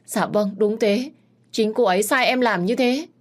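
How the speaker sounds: insistent. Acted. Maid confirms accusation.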